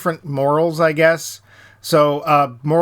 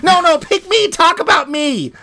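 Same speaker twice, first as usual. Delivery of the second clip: much higher, louder, begging "pick me" voice; an obnoxious kid waving a hand in class; eager smart kid; 13 semitones higher, 4 dB louder than usual. Retold or imitated. Mimics the plots as eager kids begging the teacher, high and loud. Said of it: "like the most obnoxious smart kids".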